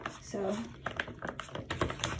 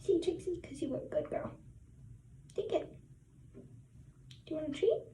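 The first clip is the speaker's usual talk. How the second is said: talking to a dog; 5 semitones higher, 9 dB quieter, faster than usual. Real, not acted. Giving the dog a treat.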